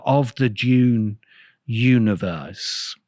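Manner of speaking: ordinary talk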